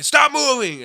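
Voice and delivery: high, loud cop's command; cop's shout. Retold or imitated. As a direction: bellow it like a cop halting runners. Retold cop halting runners.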